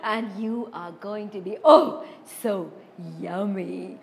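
a greedy troll licking his lips; hungry troll. Acted. Troll gloating over the medium goat he plans to eat.

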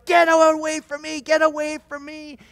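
high, loud shouted warning; screaming granny. Acted. Granny with a gun as men run, screaming. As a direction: yell it like a panicked order to back off. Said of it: "Screaming at the top of her, her lungs".

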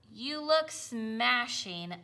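slow, lightly voiced buzzing bees. Bees tease the apple-covered tractor, slow and lightly buzzing. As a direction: tease him slowly like a swarm of bees. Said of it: "buzzed the bees".

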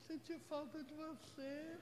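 imitating a two-faced flatterer; fake sweet voice. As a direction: coo it like a false friend. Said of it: "Pessoa falsa, ela é assim, ó".

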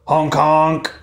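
honking like a goose. Acted. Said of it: "Missus Goose said".

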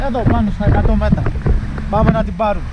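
loud and high with excitement; excited shouting. Real, not acted. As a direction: call it out excitedly. Excited news about the trailer. (ενθουσιασμένη φωνή)

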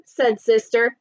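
usual speaking voice

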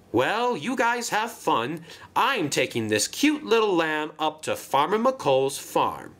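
pleased storybook engine voice; proud Marion. Acted. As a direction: show off the new job to friends.

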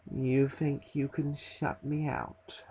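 soft, slow reptilian hiss; slow and cold, like a lizard speaking. Acted. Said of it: "comes a cold reptilian voice".

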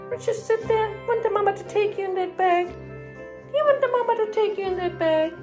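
high, cooing question to a dog; pet talk. Real, not acted. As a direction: coo high at the puppy in the bag. Teasing the dog in bag.

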